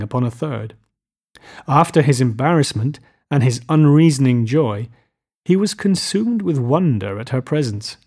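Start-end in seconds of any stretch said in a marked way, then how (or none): none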